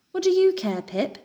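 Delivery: scornful pigeon coo; mocking coo. A narrator's reading. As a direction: sneer at her. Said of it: "she cooed".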